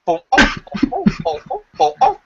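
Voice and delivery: rising high parrot squawks; parrot falling. Retold or imitated. Parrot falling down the stairs, climbing high squawks. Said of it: "All we heard was".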